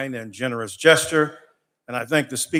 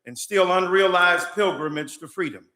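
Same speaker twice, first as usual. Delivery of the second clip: public prayer; 5 semitones higher than usual. Real, not acted. Pastor praying before the state House, impassioned preaching prayer. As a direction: pray it from the pulpit, building fire against injustice.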